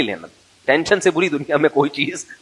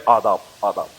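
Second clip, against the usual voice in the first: lower, dainty little aadab from a newborn; a tiny baby bowing politely with a lower voice; polite baby greeting; 7 semitones lower than usual. Retold or imitated. Preacher imitates the newborn greeting everyone with aadab, lower and dainty. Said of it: "یہ خاص style سے گردن ہلا رہا تھا".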